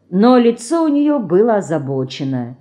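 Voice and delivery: plain reading voice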